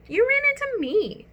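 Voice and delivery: high, quick scold; a girl scolding a careless boy. Acted. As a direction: tell him off sharply. Girl complaining.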